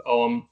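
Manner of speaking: Ogham as "ome", gh silent; silent-G pronunciation. Shown as a demonstration. Reading a question on pronunciation, Ogham with silent g. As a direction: say Ogham with no g sound. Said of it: "also about the pronunciation of".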